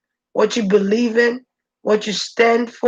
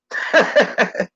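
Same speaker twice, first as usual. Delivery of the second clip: menacing chuckle. Acted. Laughing after the threat.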